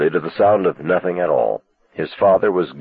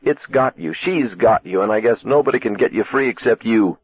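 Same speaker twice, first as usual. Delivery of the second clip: plain reading voice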